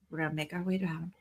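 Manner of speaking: put-on soft whisper to a child; whispered reassurance. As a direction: hush it gently. Calming son quietly.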